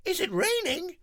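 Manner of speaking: very high squeaky call; shouting Nelly. Acted. Nelly shouting at the noise, very high. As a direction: squeak it out puzzled. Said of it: "shouts Nelly".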